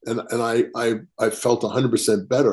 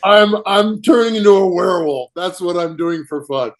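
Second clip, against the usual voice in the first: amused reply; 6 semitones higher, 5 dB louder than usual. Retold or imitated. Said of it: "I go".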